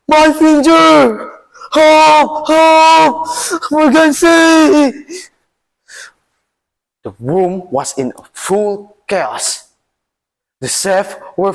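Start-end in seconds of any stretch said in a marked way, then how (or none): 0.1-5.2 high, loud, panicked cries for help; a king screaming in a high, loud panic. Acted. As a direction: scream like a king who just cut his finger. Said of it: "The king starts screaming".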